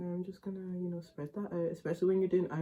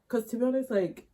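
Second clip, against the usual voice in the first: normal talking voice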